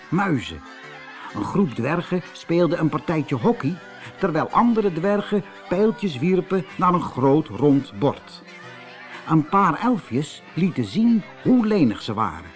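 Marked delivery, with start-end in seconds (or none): none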